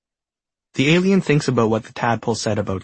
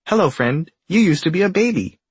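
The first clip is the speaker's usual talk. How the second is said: cheery alien greeting; 8 semitones higher, 3 dB louder than usual. Acted. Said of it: "the alien says".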